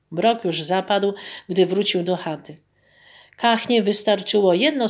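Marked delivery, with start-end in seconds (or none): none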